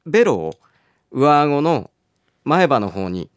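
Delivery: ordinary talk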